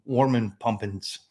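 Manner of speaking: a light, hammed-up German accent on Warman pumpins; terrible German accent. Chimney sweep to viewers, a mock German accent. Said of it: "forgive my terrible German accent".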